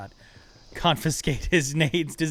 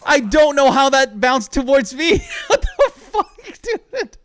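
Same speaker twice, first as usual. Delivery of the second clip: laughing; 13 semitones higher, 12 dB louder than usual. Real, not acted. Laughing after near death.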